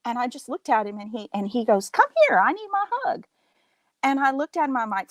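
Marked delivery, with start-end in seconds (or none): 1.9-3.3 high, insistent hug demand; eager dad. Retold or imitated. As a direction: imitate an excited dad calling someone over.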